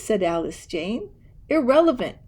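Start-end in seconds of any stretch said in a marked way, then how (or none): 1.5-2.2 a gruff, dismissive harrumph, a little louder. A narrator's reading. Uncle dismissing the children's plea, gruff harrumph. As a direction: brush the idea off with one grumpy word. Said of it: "harumphed Uncle Theodore".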